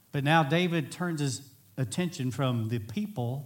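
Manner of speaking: ordinary talk, nothing marked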